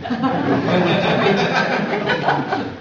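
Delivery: loud, high burst of laughter; full hearty laugh, rising; big laugh. Real, not acted. Guest laughs loudly at teacher story, big laugh.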